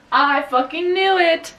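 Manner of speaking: triumphant. Real, not acted. Triumphant about a plot guess.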